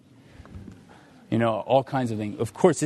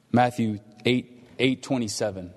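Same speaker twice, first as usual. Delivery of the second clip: usual speaking voice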